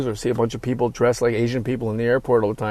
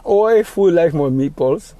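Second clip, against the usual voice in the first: mock Liverpool; 5 semitones higher, 5 dB louder than usual. Mock Beatle talk after song.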